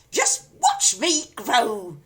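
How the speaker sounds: screechy crone. A narrator's reading. Grandma gloating.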